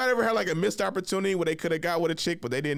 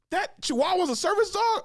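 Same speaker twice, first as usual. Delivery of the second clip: high, rising, incredulous imitation; mock disbelief. Imitating the dude doubting the chihuahua, high incredulous mock. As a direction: squeak it out like you can't believe it.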